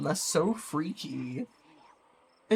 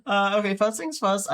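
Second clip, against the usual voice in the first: normal talking voice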